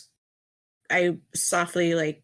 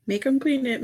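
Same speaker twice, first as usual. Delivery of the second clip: egging on; 6 semitones higher, 4 dB louder than usual. Pretend. Player cheers NPC scolding.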